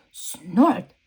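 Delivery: one short snort; pig snort. Acted. George snorting that Peppa looks pretty. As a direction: snort a yes.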